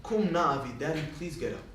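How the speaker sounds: soft, low, gentle polite request; nicely spoken. Acted. Jacob politely asks his father to rise, nicely spoken. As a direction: ask sweetly and softly, with no push. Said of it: "He spoke nicely".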